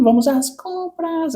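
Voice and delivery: put-on very high, slow valley girl "Let's go shopping". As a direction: squeal it like a spoiled rich girl. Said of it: "Lembra das branquelas falando".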